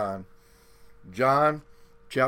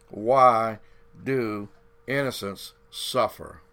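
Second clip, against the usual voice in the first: normal talking voice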